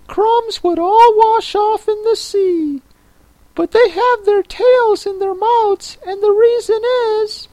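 very high, squeaky creature voice; Mock Turtle. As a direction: correct her in a tiny, very high voice.

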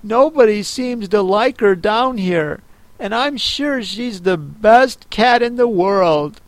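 put-on a lonely girl missing her cat; mild sadness. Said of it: "in a sad tone".

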